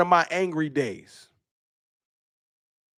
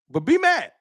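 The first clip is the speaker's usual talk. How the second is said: mock taunt; 8 semitones higher than usual. Taunting the hater.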